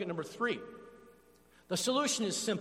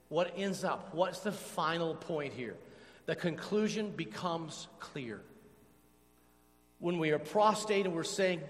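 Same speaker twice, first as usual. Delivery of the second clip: ordinary talk, nothing marked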